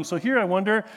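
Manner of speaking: ordinary talk, nothing marked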